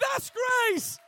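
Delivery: high, loud preacher's shout. Real, not acted. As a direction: shout it to the back row. Shouted climax of sermon.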